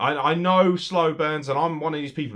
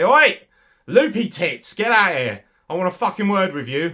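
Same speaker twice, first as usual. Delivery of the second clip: loud, higher, brash call-out; mock challenge; 6 semitones higher, 8 dB louder than usual. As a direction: yell her out to the ring. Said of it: "she's basically gonna say".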